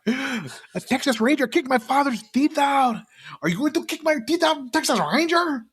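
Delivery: high mock villain taunt; movie villain quote. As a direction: sneer it like the bad guy. Quoting villain line from movie.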